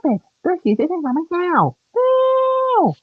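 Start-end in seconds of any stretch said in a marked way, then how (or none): 1.9-2.9 a high, put-on wail of no; protesting no. Real, not acted. Child protesting when a sibling grabs the game, a high wail. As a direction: cry out against the intrusion.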